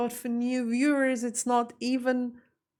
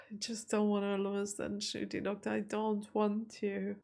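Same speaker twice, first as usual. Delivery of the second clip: sad softly; 8 dB quieter than usual. Real, not acted. Dreading losing the Doctor.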